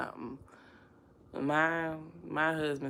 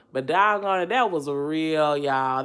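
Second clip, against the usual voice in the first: emphatic; 2 semitones higher, 10 dB louder, slower than usual. Real, not acted. Insists her crying video was real.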